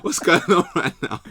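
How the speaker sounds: high, loud mock panic; acting out his own confusion at the frozen screen; confused self quote. Retelling the frozen call in a high, loud mock panic. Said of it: "So I was like".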